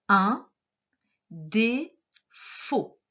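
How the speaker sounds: UN DÉFAUT in three slow separated pieces; word dictation. Shown as a demonstration. Teacher repeating a word for dictation, slow syllable-by-syllable. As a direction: pause after UN and inside DÉFAUT. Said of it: "le dernier mot".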